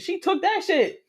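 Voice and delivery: high-pitched indignant punchline; outraged disbelief. Real, not acted. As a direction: pitch jumps up in disbelief at the theft. Punchline of theft story.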